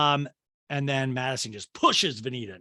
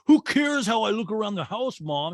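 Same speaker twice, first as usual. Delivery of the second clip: higher, louder imitation of an irked son; mock protest; 7 semitones higher, 5 dB louder than usual. Son answering his mother about his looks, mock protest. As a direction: push back at mother in a put-on voice.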